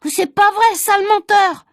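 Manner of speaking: put-on high, loud childish protest; indignant shout. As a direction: hurl it back at the liar. Nicolas accusing Agnan.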